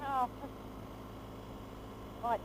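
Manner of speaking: ordinary talk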